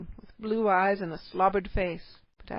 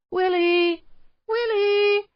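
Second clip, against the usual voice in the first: very high, loud calls into the dark; calling out; 12 semitones higher, 8 dB louder, much slower than usual. A narrator's reading. Parents call their missing son through the cave, loud. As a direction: shout for the lost boy. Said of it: "shouting into the dark passages".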